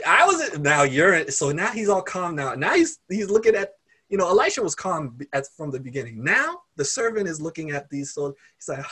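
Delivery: ordinary delivery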